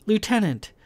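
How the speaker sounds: put-on high, formal Hal accent; proper Hal accent. A narrator's reading. Verlin cutting Kai off, proper Hal accent. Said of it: "she said in her proper Hal accent".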